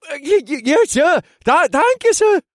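very high, loud stammering; nervous stammered thanks; stammering thief. Acted.

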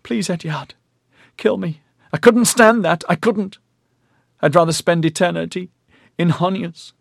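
high, tearful begging; weeping. A narrator's reading. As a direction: plead through the tears. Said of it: "she wept".

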